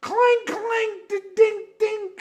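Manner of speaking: high clangy dings; guitar imitation. Host mocking the guitar playing, high clanging sounds. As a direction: mimic a sloppy guitar. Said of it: "it's like".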